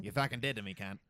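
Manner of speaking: Australian accent demo. Shown as a demonstration. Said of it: "I do an Australian accent".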